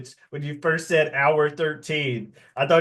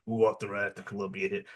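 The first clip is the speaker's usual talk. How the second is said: low, soft mobster murmur; hushed, lowered mock-godfather voice; imitated whispering godfather; 6 semitones lower, 7 dB quieter, faster than usual. Godfather asking who ordered a hit, hushed and low. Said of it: "he's whispering and just kinda".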